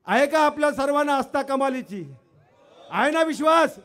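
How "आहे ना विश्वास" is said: shouting to crowd. Real, not acted. Rallying the crowd for response.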